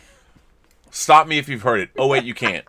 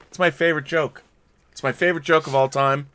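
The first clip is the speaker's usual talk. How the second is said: usual speaking voice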